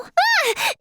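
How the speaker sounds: short high shriek; startled yelp. Acted. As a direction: yelp in sudden fright. Startled by someone.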